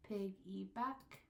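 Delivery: slow, "Piggyback" in three separate syllables. Shown as a demonstration. Teacher sounding out a word for counting, slow and separated. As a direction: draw out each syllable of "Piggyback". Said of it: "three syllables".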